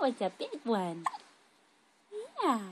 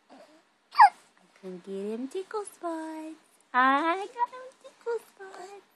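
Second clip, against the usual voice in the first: playful tickle talk; 8 semitones higher, 7 dB louder, slower than usual. Real, not acted. Mother playfully tickling her baby.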